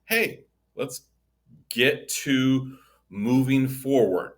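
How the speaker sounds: normal talking voice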